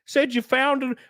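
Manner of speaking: high, whiny mocking critic; high mock of online critics. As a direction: needle them in a high voice.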